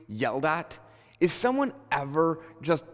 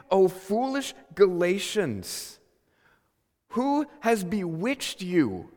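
higher, very slow, drawn-out reproach; climactic rebuke; 5 semitones higher, 3 dB louder, slower than usual. Acted. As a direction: declaim it as Paul's exasperated climax, word by word. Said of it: "it climaxes right here".

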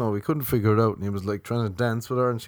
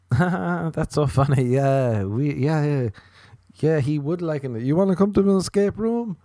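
fake eager agreement; 7 semitones higher than usual. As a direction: play the over-keen, horny date laughing along. Acting eagerly agreeable horny date.

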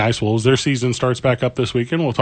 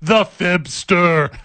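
mock dramatic accusation; 4 semitones higher, much slower than usual.

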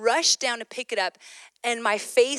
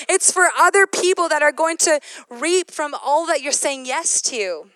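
loud, higher passionate exhortation; fervent preaching; 5 semitones higher, 4 dB louder than usual. Acted. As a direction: preach it hard, pushing them to obey. Urges listeners with full force.

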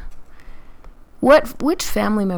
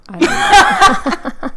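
laughing; 13 semitones higher, 6 dB louder, much slower than usual. Real, not acted. Laughs off her own advice.

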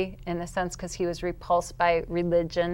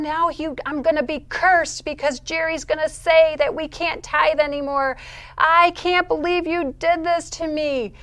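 high, loud, anguished shouting at God; wailing tantrum; 10 semitones higher, 6 dB louder than usual. Retold or imitated. Woman raging at God after missed payment, wailing tantrum. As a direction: wail accusations at full volume. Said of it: "I am just wailing".

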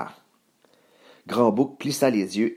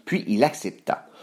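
usual speaking voice

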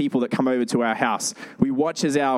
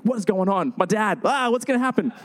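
put-on panicked little kid; 7 semitones higher than usual.

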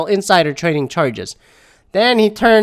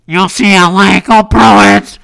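loud, high cheer of "go Bruins"; sudden raised-voice rallying shout; team cheer; 5 semitones higher, 7 dB louder, slower than usual. Pretend. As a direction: belt it out like a fan in the stands. Breaks off to cheer his team.

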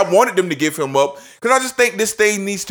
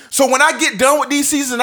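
ordinary talk, nothing marked

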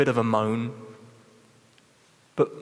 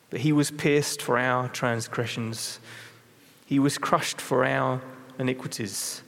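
scripture reading. Acted. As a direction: lay each line down gravely, letting it sink in. Reads Isaiah fifty-three.

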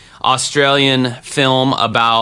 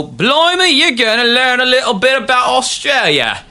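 a hammed-up, bad Australian accent, pitched much higher and slowed down; awful Australian accent; 14 semitones higher than usual. Podcast host introducing the Australia episode in an awful Australian accent. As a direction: put on a cheesy Aussie twang to kick off the segment. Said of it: "That was probably an awful Australian accent".

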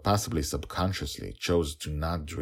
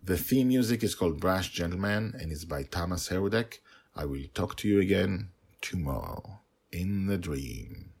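usual speaking voice

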